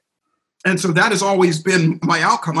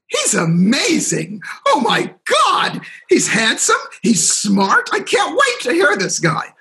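much higher, hammed-up starstruck gushing; mock audience gushing; 10 semitones higher, slower than usual. Imagined audience admiring the host, hammed-up high gushing. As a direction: gush like a swooning fan, joking. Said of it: "you're thinking, 'Look at him".

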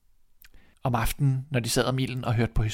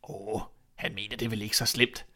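low, soft inner voice; thinking to himself; 3 semitones lower, 6 dB quieter than usual. Acted. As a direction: reassure yourself under your breath. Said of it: "tænkte degnen". (dæmpet, for sig selv)

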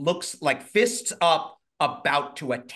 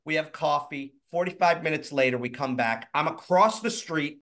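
usual speaking voice

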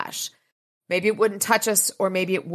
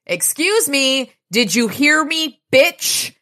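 high, slow, loud taunt; slow angry holler; 8 semitones higher, 5 dB louder, slower than usual. Acted. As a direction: spell it out in a yell. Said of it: "he starts hollering at Barbie".

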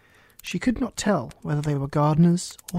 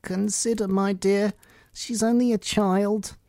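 higher, slow, gentle plea; timid; 4 semitones higher, slower than usual. A narrator's reading. King soothes the angry Queen, timid pleading. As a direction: plead meekly with a formidable wife. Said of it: "timidly said".

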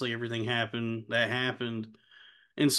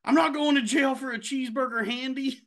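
pitched-up put-on voice of the sitcom hooker; mock Patty voice; 11 semitones higher than usual. Podcaster quoting a sitcom hooker's courtroom excuse, higher put-on voice. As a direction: play the hooker defending herself on the stand, voice pushed higher. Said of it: "she's like".

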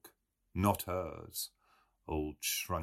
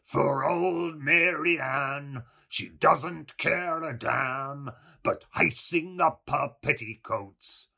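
loud, slow, rasping drone; hoarse rasping growl; 8 semitones higher, 8 dB louder, slower than usual. A narrator's reading. Mulligan hewing at the loaf, droning his ditty in a rasp. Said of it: "growled in a hoarsened, rasping voice".

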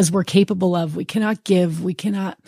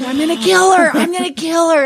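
angry man; 9 semitones higher than usual. Retold or imitated. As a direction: play the furious husband, half-mocking. Husband threatens with a gun.

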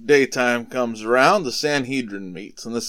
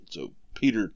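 plain reading voice

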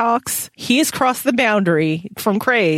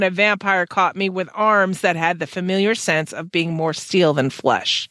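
ordinary talk

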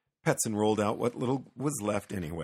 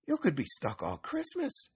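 higher-pitched, concerned neighbour; Axel; 12 semitones higher than usual. A narrator's reading. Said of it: "Axel said".

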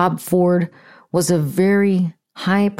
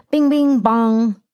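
mock Joe Pesci; 3 semitones higher, slower than usual. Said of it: "Joe Pesci wannabe".

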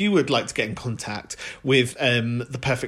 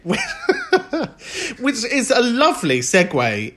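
high, slow, laughing through the words; real laughter, pitched up; 9 semitones higher, much slower than usual. Real, not acted. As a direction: giggle your way into the segue. Laughing at own joke.

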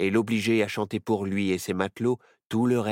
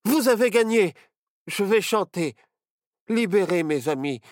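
high, raised pleading voice; urgent surrender; 10 semitones higher, 6 dB louder than usual. A narrator's reading. Barnabé offers to sing for his friends' release, urgent. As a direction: give in urgently to save friends.

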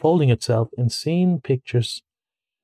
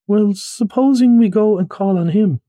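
higher, louder hopeful asking; eager mole; 8 semitones higher, 4 dB louder than usual. A narrator's reading. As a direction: pitch it up with eager hope. Mole suggests a visit.

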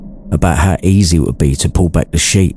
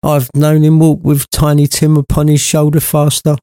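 sad mother; 9 semitones higher than usual. A narrator's reading. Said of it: "she remarked".

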